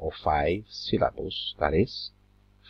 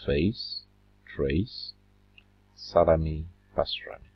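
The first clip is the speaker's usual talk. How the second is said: ordinary talk, nothing marked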